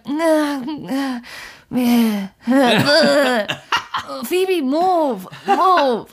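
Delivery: lightly voiced sleepy mumbles, breaking into laughter; mock sleepy mumbling. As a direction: play back groggy sleep talk, half laughing. Imitating two sleepers on recording.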